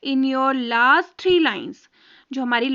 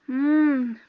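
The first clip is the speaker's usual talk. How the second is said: whispered hum. Pretend. Teacher pretends to think.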